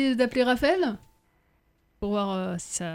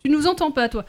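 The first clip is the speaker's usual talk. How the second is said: raised voice, calling to a bad line; 3 semitones higher, 5 dB louder than usual. Real, not acted. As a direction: call out to be heard. Calling to caller on phone.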